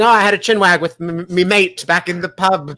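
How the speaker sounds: a hint of a British pub voice, barely shifted; half-hearted British accent, a touch brighter at the start; bad British accent. Host putting on a British accent for the quiz, faintly. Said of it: "I've never been one for accents".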